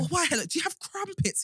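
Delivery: high, swooping mock-British accent. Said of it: "live up to the stereotype".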